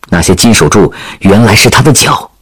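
usual speaking voice

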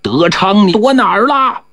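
high-pitched acted bellow; big-voiced holler. A narrator's reading. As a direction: holler out searching for someone. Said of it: "有个嗓门特大的吼着".

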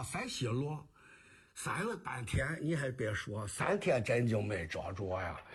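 comic kuaishu storytelling in Jinan dialect. Shown as a demonstration. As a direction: tell it as Shandong rhymed patter. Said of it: "您这个济南话". (济南话山东快书)

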